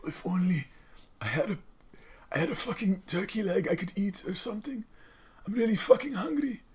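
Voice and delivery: high-pitched, put-on ancestor voice; hungry desert ancestor. Starving ancestor in the desert longing for food, high put-on voice. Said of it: "some ancestors who were in a fucking".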